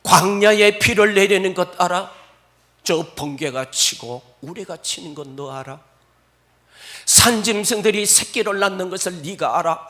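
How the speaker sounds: loud, booming questioning; God's challenge. Acted. God questions Job, loud and booming. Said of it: "하나님이 욥에게 물어요".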